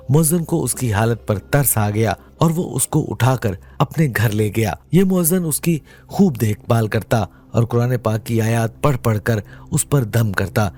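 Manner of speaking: plain reading voice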